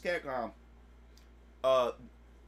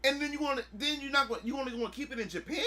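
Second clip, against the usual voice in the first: high, heated complaint; fanboy outrage; indignant rant; 8 semitones higher, 4 dB louder than usual. Retold or imitated. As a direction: rant at the unfairness. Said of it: "I was like".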